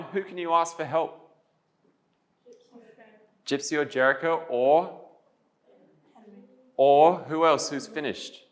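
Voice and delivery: high-pitched, measured prompting with pauses for answers; classroom teacher voice. Real, not acted. Teacher asking pupils who can help them, in classroom voice. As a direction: lead pupils along with lifted questions.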